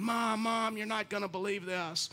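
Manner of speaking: high, softened mock scream; excited kid calling Mom, played at half volume; thin high-pitched imitation of a child yelling from afar; imitated screaming kids. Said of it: "you hear 'em just screaming".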